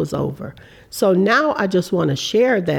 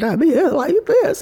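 mock shaky voice; 10 semitones higher than usual. Shown as a demonstration. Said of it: "my voice is shaking".

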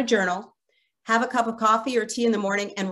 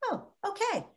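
relieved sigh; 11 semitones higher, 9 dB quieter than usual. Retold or imitated. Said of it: "you're gonna be like".

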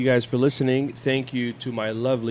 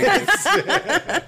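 high, slow, laughing wife impression; mock wife voice. Husband mimicking wife after her denial, high and slow. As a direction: drag it out in her voice. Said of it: "I don't talk like this".

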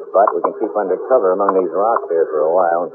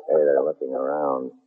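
hushed; 6 semitones lower, 7 dB quieter than usual. Acted. Hiding from soldiers nearby.

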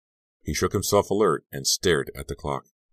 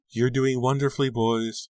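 mother calling from shore; 9 semitones higher than usual. A narrator's reading. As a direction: call proudly to the boys from the riverbank. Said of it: "called, waving to them from the shore".

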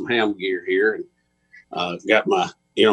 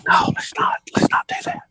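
whispering. Real, not acted. Sneaking past sleeping kids.

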